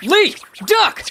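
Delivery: high urgent shout; shouting warning. Acted. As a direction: yell the warning.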